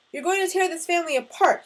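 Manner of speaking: loud, high outburst at his son; angry scream. A narrator's reading. Father screams at son over leaving home, angry shout. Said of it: "he screamed, shaking his hands in the air".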